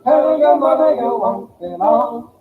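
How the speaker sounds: ordinary talk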